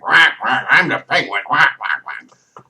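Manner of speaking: quacking cartoon bird-villain voice; Penguin. Vlogger mimicking his idea of Penguin, quacking villain. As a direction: squawk it like a silly duck, over the top. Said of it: "But when I think Penguin".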